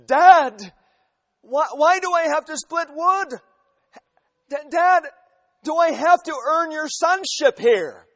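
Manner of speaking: put-on whiny teenage son. Preacher voicing teenage son complaining.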